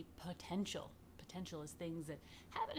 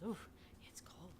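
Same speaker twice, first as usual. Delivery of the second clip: breathy shivery whisper about the chill; a hushed oof against the cold; 6 dB quieter than usual. Real, not acted.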